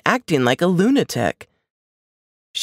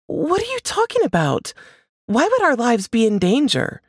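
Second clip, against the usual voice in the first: alarmed wife; 9 semitones higher than usual. A narrator's reading. Wife alarmed by husband's warning.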